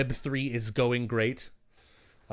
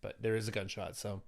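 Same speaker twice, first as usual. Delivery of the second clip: ordinary talk, nothing marked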